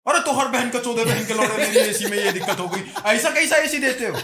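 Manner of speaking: loud, high mock tirade from an angry customer; irate caller. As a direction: imitate a caller exploding with abuse. Abusive Bihar caller voiced in story. (गुस्सैल ग्राहक की ऊँची, तेज़ आवाज़ में नकल)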